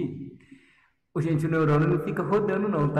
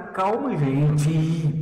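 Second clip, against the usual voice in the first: very slow, louder, stretched; drawn-out calm; 5 dB louder, much slower than usual. Real, not acted. Teacher calming her impatient class, slow and drawn out. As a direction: stretch each word to settle them.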